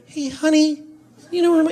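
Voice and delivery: high, reedy and nasal. Retold or imitated. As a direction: whine it out thin and high. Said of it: "that annoying, reedy thing".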